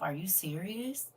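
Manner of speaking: normal talking voice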